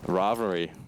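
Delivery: ordinary talk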